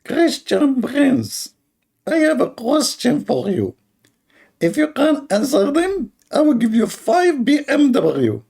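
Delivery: very high, slow mocking caller voice; mocking high drawl. Mocking a caller who offers five BMWs, squeaky and slow. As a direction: stretch it out in a squeaky put-on voice. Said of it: "I don't know why he's talking like this".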